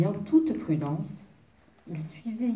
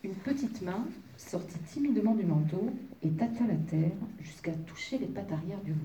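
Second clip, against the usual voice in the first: usual speaking voice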